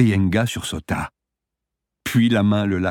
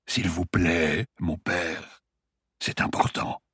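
a hint of a weak old patient; dying man voice; 5 dB quieter, slower than usual.

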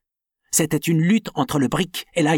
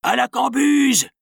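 Penn's gang shouting for the liquor; rowdy shout; 10 semitones higher, slower than usual. Acted. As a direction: roar it toward the storeroom. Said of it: "s'écrièrent Penn".

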